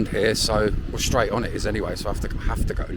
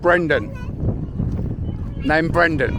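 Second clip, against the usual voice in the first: calling out; 9 semitones higher than usual. Real, not acted. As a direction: speak up clearly so they understand. Calling to children.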